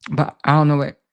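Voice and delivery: talking around a cough drop; mouth full. Real, not acted. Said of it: "I got this cough drop in my mouth".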